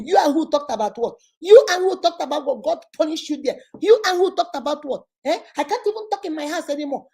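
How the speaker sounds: high, indignant comeback, repeated harder; quarrelsome. Acted. Coach acting out a husband snapping back in a quarrel, quarrelsome.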